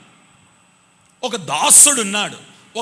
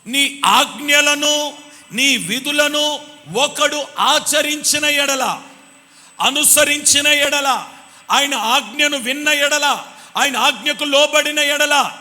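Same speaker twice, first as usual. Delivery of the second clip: slow, higher emphatic sermon read; slow, higher preacher cadence; measured preaching; 5 semitones higher, 3 dB louder, slower than usual. Acted. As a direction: lay each clause down slowly from the pulpit. Preaching the verse.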